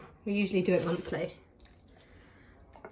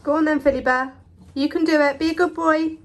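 bright high cheering on; encouraging the dog; 6 semitones higher, 5 dB louder than usual. Real, not acted. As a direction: cheer him on, higher and louder.

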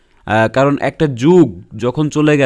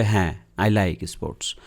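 bored stock answer; 7 semitones lower, 7 dB quieter, much slower than usual. Retold or imitated. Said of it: "অনেকে বলবে".

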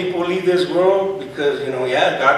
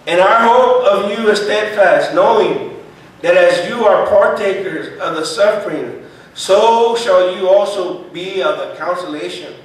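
loud, emphatic preacher's Bible reading; scripture reading; 4 semitones higher, 6 dB louder than usual. Acted. Preacher reading verse three to the congregation, loud scripture reading. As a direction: proclaim the verse with force.

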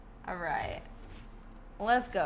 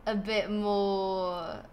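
normal talking voice

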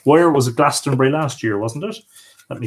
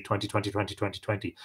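quick rattled off; 5 semitones lower, 13 dB quieter, faster than usual. Shown as a demonstration. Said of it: "Let's just say".

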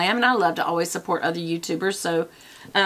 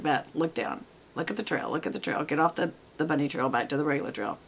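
low, hushed talking-to to yourself; softly steer yourself back on track; quiet self-coaching; 5 semitones lower, 8 dB quieter than usual. Pretend. Vlogger scolding herself off a bunny trail, quiet and low.

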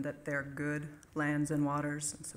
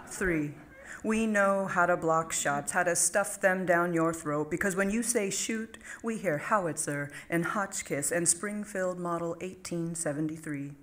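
comic poem recitation; 3 semitones higher, 4 dB louder than usual. Acted. Poet reading a humorous top ten to an audience, emphatic recitation. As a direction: list poem read out with announced numbers and pauses.

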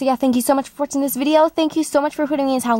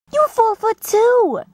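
high slow disbelief; 10 semitones higher, slower than usual. Acted. Character exclaims in disbelief.

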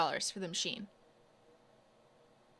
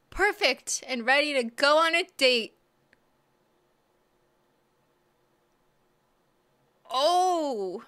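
higher, louder thrilled admiration; delighted; 6 semitones higher, 7 dB louder, slower than usual. Real, not acted. Showing viewers the finished manicure, delighted. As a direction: gush over the finished nails.

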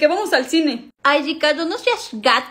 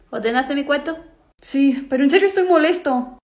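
ordinary talk

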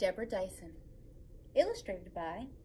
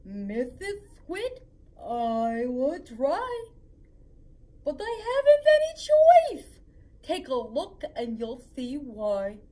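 exasperated fish; 11 dB louder than usual. Acted. Fish pleading with the squid, breaking into a loud cry. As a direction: plead that you have no choice.

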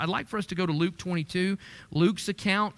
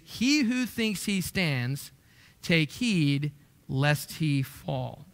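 each word drawn out slowly and weighed; slow solemn reading; 3 semitones lower, much slower than usual. Shown as a demonstration. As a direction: read the verse slowly, like a warning. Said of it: "First Corinthians ten and verse twelve is".

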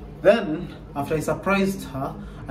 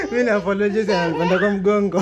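talking through big laughter; laughing; 4 dB louder than usual. Real, not acted. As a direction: laugh while you speak. Laughing at wife climbing.

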